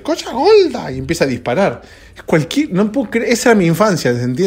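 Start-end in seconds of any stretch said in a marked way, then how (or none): 0.0-0.9 put-on shrill squeaky baby yelp, much higher; angry baby. Host imitating the cartoon baby Waki firing his guns, squeaky and high. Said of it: "se enoja y dice".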